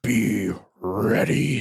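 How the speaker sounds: very slow, grave warrior voice. Pretend. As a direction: intone it like a stoic warrior. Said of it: "This is how warriors talk".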